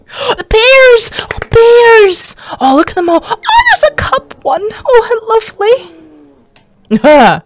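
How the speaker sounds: very high, gushing excitement; gasp, then squeal with real delight; cooing over cute animals, voice shooting up. Real, not acted. Delighted at bears in the video.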